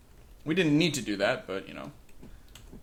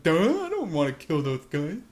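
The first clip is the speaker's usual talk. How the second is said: dopey orc voice; 3 dB louder than usual. Pretend. The party's orc objecting to killing orcs, dopey voice. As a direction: play the thick, good-natured orc. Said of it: "Sluggur's probably like".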